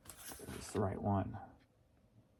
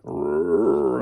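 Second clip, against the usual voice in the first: lower, louder mock roar; growling innards; 7 semitones lower, 9 dB louder than usual. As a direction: voice the growling stomach. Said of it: "My innards are talking to me".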